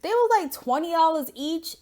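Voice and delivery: high, indignant about the price; outraged disbelief. Real, not acted. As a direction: balk at the cost.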